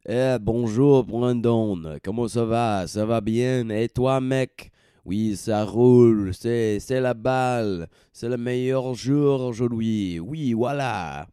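hammed-up French chatter with himself, partly slow; playful French accent. Host back from Paris greets himself in mock French. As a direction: put on a jokey Parisian voice. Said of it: "I'm talking like that because I just got back from Paris".

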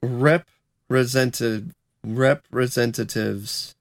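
"representatives" broken into slow separate syllables; drawn-out, deliberate "representatives"; slow careful practice. Real, not acted. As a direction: sound out "representatives" piece by piece. Practising the word that trips him up.

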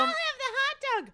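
loud, squeaky inner voice; high-pitched voice. Acted. One of the arguing voices in her head, high-pitched. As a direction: shoot way up high, comically. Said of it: "So one is high pitched".

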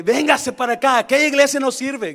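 high loud mock invitation; rival pastor luring people. Preacher mocks pastors calling people away. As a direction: mimic a pastor poaching members. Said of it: "He escuchado por ye-, pastores".

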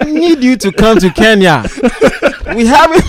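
high, loud hammed-up African accent; mock African accent through laughter. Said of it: "And then I did an African accent".

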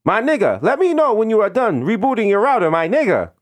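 put-on insistent scripted politeness in Indian accent; Indian call-center agent. Agent waiting on caller's reboot, formal mock Indian accent.

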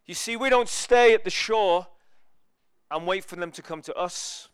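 loud, slow, high preaching build; rousing sermon climax; full-force preaching. Acted. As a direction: proclaim it to the whole hall.

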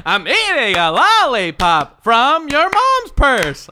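loud, high, drawn-out singer impression. Player improvising an answer in the game, hollered impression. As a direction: holler it slowly in rhythm. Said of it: "an impersonation of [name]".

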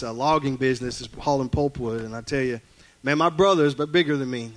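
normal talking voice

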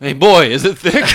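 put-on wholesome 1950s kid. Hammed-up gushing over thick penis.

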